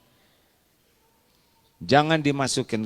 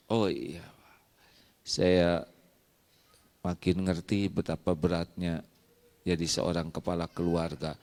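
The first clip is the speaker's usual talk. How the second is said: meek wife; 9 semitones lower, 7 dB quieter than usual. Acted. Wife yielding to husband, soft and tearful. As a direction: lower and softer, near tears.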